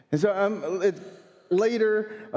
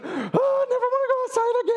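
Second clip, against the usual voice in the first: put-on much higher, fast whiny child voice with a laugh; scared little girl; faster than usual. Daughter to dad in the car, high whiny child voice. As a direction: wail it like a frightened five-year-old.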